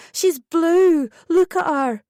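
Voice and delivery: high loud urgent demand; angry alarm. A narrator's reading. Mother demanding the nurses look, angry and loud. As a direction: force them to look. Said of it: "I was angry now".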